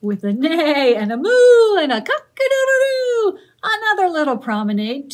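loud and high sing-song refrain; cheerful shouted chorus; big refrain. Acted. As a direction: belt the refrain out to the children. Refrain repeated.

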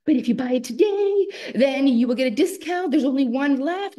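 high, loud pushy sales pitch; pushy saleslady. Retold or imitated. Kiosk lady pressing a customer to buy, high and pushy. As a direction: push the sale hard, high and bright. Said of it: "And she's like".

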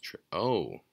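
ordinary delivery

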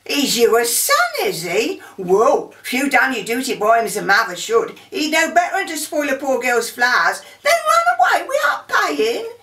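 put-on loud, indignant Cockney flower girl; outraged Cockney street shout; broad Cockney. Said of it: "I'll give you an example of, uh, of how we have to, to learn how to speak Cockney".

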